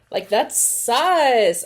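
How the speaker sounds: pointed suspicion; slow and loud, calling it out; slow judgy. Real, not acted. Slow judgemental comment.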